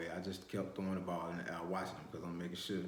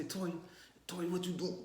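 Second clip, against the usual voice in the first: calling out; 11 semitones higher than usual. Retold or imitated. Calling out to his cousin.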